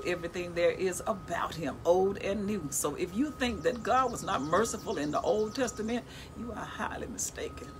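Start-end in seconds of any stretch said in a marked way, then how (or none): 6.4-7.7 whisper. Real, not acted. Quiet emphatic aside.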